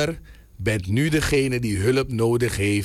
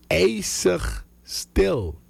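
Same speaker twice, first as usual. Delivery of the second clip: very slow, higher, with suspense; dramatic slow reveal; 6 semitones higher, much slower than usual. Real, not acted. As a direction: draw out each word for suspense. Dramatic reveal of sudden silence.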